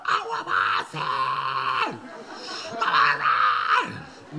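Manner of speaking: strained, breaking mock cry, dragged out slowly; mock wailing. Little sister melting down in the car, mock sobbing shout. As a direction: wail it like a toddler in a car seat meltdown. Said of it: "Sister got in the car and was like".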